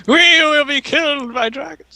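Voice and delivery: high-pitched hammed-up wail of doom; mock panic. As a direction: cry out in pretend terror. Mock panic about dragons.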